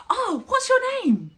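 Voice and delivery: high, loud and drawn out with delight; excited tone. Shown as a demonstration. Teacher greeting a learner, excited and eager. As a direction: sound thrilled to meet them. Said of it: "I'm using quite an excited tone".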